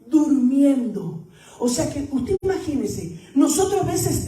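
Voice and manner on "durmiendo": durmiendo stretched out slowly, each syllable held; slow, drawn-out emphasis. Shown as a demonstration. Pastor repeats to the church that Jesus slept, slowly. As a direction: linger on durmiendo to make it land. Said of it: "estaba durmiendo".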